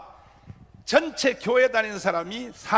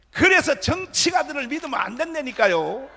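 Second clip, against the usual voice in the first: shouted sermon, pressing the crowd; fiery preaching; 4 semitones higher, 5 dB louder than usual. Acted. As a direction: preach it hard at them.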